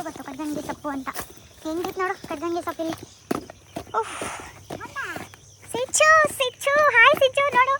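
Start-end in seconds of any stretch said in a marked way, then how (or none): 5.7-7.7 high, loud, slow stretched hello; light up at seeing Sinchu; slow high delighted call; excited greeting. Real, not acted.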